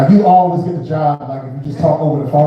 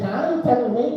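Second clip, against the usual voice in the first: mock host voice; 9 semitones higher, 5 dB quieter, slower than usual. Host jokes a mock intro.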